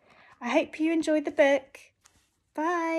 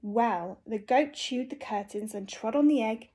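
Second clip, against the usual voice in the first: usual speaking voice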